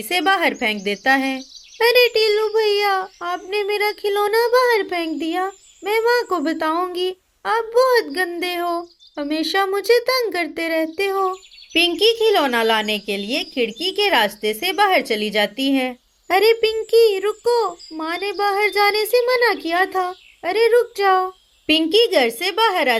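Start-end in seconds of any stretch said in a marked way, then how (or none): 1.8-11.3 put-on high complaining girl's voice; whiny little girl. Little sister scolding her brother, high whiny voice. 16.3-21.3 put-on high boyish call to stop. Brother calling his sister to come back, high child voice. Tilu calls after Pinki.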